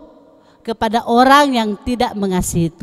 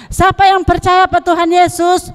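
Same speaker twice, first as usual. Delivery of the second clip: higher, louder asking voice; pastor asking; 6 semitones higher, 5 dB louder than usual. Shown as a demonstration. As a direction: call the question out to the kids. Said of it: "Si pendeta mau tanya". (pendeta bertanya dengan lantang)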